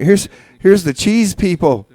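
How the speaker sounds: normal talking voice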